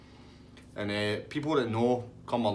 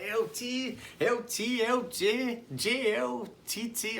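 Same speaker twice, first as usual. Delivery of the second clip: much higher-pitched mocking character voice; teasing Tasty Dave; 13 semitones higher, much slower than usual. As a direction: reel off wrong nicknames in a high squeal. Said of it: "He's like".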